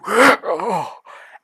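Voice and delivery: loud, high acted gasp; shocked gasp. Ghost passes through the character, loud shocked gasp. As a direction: gasp sharply at a sudden chill.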